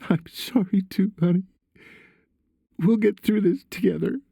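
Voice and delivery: high, comforting mother's voice; tearful mother. A narrator's reading.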